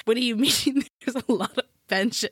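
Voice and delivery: imitating bafflement while cracking up; amused, laugh-broken mock incredulity; laughing through quote. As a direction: retell the driver's disbelief through laughter. Laughing while retelling driver's bafflement.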